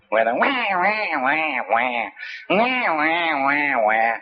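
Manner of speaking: high mock baby crying wah wah; lightly hammed-up baby cry; imitated baby wail. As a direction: wail like a colicky infant, pitched high.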